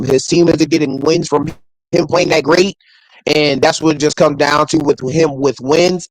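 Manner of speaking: usual speaking voice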